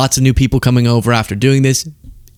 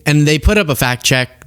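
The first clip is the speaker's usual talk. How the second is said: ordinary delivery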